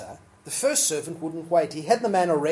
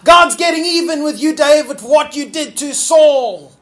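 higher, loud jeering shout; cursing shout; 9 semitones higher, 11 dB louder than usual. Acted. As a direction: curse the fleeing king at full voice. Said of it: "he curses him, going".

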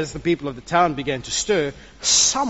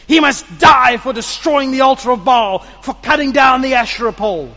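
loud, higher furious voice; angry mob; 7 semitones higher, 9 dB louder than usual. Acted. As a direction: condemn him at full volume. Mob demands Gideon's death.

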